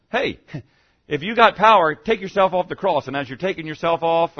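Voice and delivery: loud jeering call with a laugh; jeering thief, loud and mocking; mocking taunt. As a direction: taunt him from the cross, laughing. Thieves on the cross taunting.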